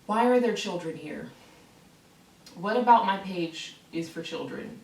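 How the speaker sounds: ordinary delivery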